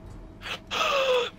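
sudden high gasp; sharp gasp. Real, not acted. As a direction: gasp in shock.

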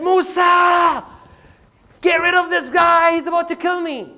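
desperate cry for help. Acted. Oppressed man begs Musa to save him from his attacker, desperate shout.

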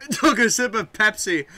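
coughing. Real, not acted. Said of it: "Oh, I just coughed".

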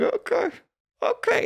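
very high-pitched squeaky okay; reenacted strained okay. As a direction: squeak it out while pretending to cope. Reenacting panic while bombing on stage.

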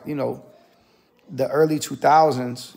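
ordinary talk

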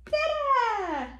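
high Dada sliding down; playful drawn-out high cheer; exclaimed refrain. Acted. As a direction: sing it out high for baby. Parent cheers book's refrain to child.

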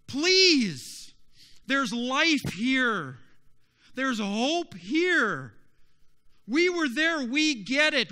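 loud, high-pitched impassioned plea; desperate begging. Acted. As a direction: beg them at full voice, stretching key words. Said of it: "are literally begging".

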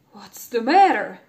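loud alarmed call; the king calls out in alarm; king crying out. A narrator's reading. King cries out when the princess gasps. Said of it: "cried the king".

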